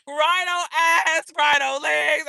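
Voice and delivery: usual speaking voice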